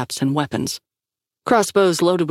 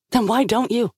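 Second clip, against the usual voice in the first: snapped; 7 semitones higher than usual. A narrator's reading. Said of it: "Shaw snapped".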